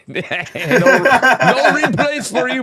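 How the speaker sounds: high, slow words squeezed out through real laughter; cracking up while teasing; loud giggling taunt; laughing. Real, not acted. Laughing at friend's replay woes.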